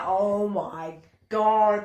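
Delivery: slow, loud, drawn-out groaning words; imitating an embarrassed boy, long and slow; mortified reaction. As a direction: stretch it out in mock horror. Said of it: "He's like".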